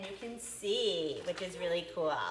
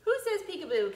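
bright higher echo of the title; excited; 8 semitones higher, 4 dB louder, faster than usual. Shown as a demonstration. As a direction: say it back with a lift. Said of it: "repeats the title".

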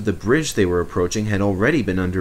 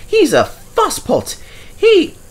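high indignant engine voice; protesting; 4 dB louder than usual. A narrator's reading. Mavis protesting to the manager, high and whiny. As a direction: whine the complaint, high and loud.

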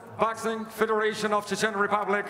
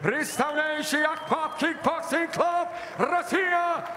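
long drawn-out fighter name shout; arena announcer; 8 semitones higher, slower than usual. Real, not acted. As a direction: stretch the name, peak on Токаев. Fighter introduction, name called.

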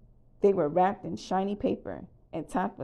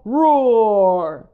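loud, long voiced roar; dinosaur roar; 3 semitones higher, 14 dB louder than usual. A narrator's reading. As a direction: roar out the word, drawn out and falling. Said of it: "Sorry about the roar".

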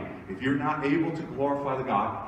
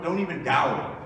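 normal talking voice

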